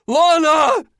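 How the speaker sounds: very high, loud drawn-out call; yell. A narrator's reading. Father hearing his daughter's distant voice, yelling. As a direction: yell back to your lost child. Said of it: "Lester yells".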